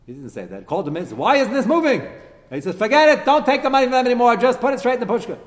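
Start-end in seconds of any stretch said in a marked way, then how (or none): 1.2-2.0 high, loud impatient question; sharp rebuke. Retold or imitated. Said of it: "That's not a way to talk to you". 2.8-5.4 high, loud, brisk orders; loud command. Retold or imitated. King ordering the kohanim to stop taking money, loud command. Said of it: "That's not a way to talk to you".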